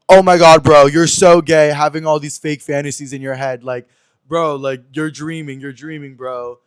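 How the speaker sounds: loud taunting bro voice; mocking yell. Ex-friend mocking him in the group chat, loud and taunting.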